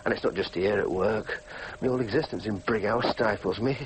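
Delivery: low, subdued brooding; glum confession. Acted. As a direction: brood to yourself, pitch sunk. Alone, lamenting his life.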